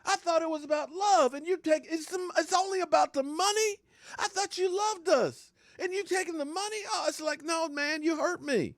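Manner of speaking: high, whiny jilted-lover wail; mock heartbroken. Fan wailing at the player who left for money, mock heartbroken. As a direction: ham up the betrayed sweetheart.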